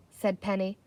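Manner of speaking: usual speaking voice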